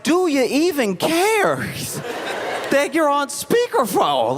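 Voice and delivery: high, drawn-out incredulous question; mock incredulity. Comic reacting to the speakerphone blunder, high mock disbelief. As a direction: exclaim it high, stretching the words out.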